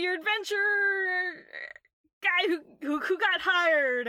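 put-on high, loud, boisterous drunk; drunk bard. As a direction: burst in as a tipsy bard announcing himself. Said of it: "the guy who's very clearly drunk".

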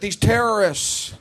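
slow, halting Bush voice groping for a word; Bush impression. Comic imitating the president stuck mid-sentence, slow and halting. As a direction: stall as Bush, searching for the last word. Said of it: "almost all the way through the sentence".

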